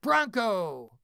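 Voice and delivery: loud company cry; shouting. Retold or imitated. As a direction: yell it together.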